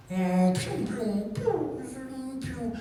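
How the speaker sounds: high little pew-pew shots; mock laser zaps in play; toy gun noises. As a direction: imitate a child's toy gunfire. Playing shoot-out with toys.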